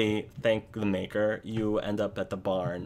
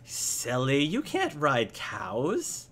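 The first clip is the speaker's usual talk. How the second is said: put-on higher child voice, slowing at the end; amused little boy; 5 semitones higher, slower than usual. Boy correcting the silly visitor, amused child voice. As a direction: tease lightly as a small boy, stretched out. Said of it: "the boy stops laughing".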